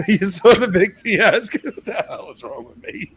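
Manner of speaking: cracking up at himself mid-sentence; laughing. Real, not acted. Podcast host laughing at his own odd word.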